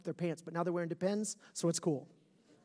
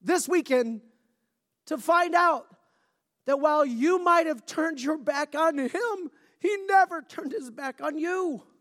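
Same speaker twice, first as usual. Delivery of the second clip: loud, higher, urgent preaching; preaching at full force; 9 semitones higher, 8 dB louder, slower than usual. Acted. Pastor pleading with wanderers from God, loud fervent preaching. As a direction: drive it home with slow punches.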